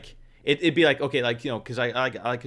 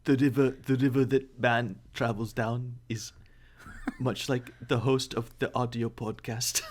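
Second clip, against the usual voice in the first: Werner Herzog; 5 dB quieter than usual. Acted. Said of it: "that wasn't even a good impression".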